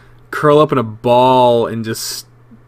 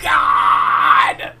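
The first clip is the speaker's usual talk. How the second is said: exasperated groan; 7 semitones lower than usual. Real, not acted. Exasperated after the failed voice.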